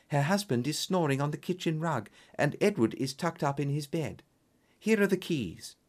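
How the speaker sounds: high-pitched client giving her report; earnest female client. Acted. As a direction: report carefully in a high, ladylike voice. Voices Miss Hunter reporting.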